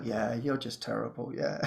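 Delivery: low, very soft gloomy muttering; mock self-loathing. Ego enjoying the victim story, imitated in low, soft gloom.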